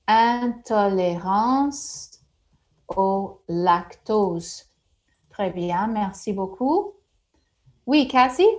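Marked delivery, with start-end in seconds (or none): 0.1-4.7 intolérance au lactose said very slowly; slow teacher modelling. Shown as a demonstration. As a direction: drag out intolérance au lactose for the class. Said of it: "teacher models vocab slowly".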